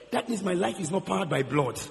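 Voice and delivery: ordinary talk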